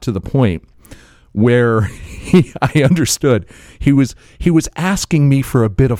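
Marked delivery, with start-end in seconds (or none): none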